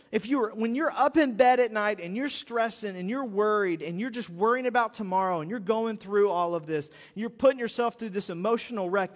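ordinary talk, nothing marked

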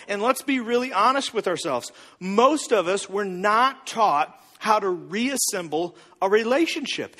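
usual speaking voice

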